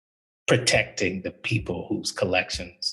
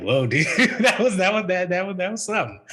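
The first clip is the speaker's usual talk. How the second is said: higher, laughing through the words; laughing disbelief; 10 semitones higher than usual. Real, not acted. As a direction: laugh it out in shock. Reacting to offensive article.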